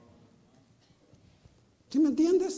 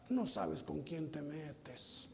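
low, slow mock menace; a quiet, slowed-down threat of revenge; 9 semitones lower, 12 dB quieter, much slower than usual. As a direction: mutter it like a grudge. Voicing a resentful threat.

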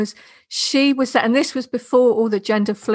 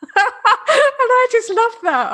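high, laughing through the words, slowed; delighted laugh spilling into the words; 12 semitones higher, 4 dB louder, slower than usual. Real, not acted. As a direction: gush with a real laugh. Delighted laugh while speaking.

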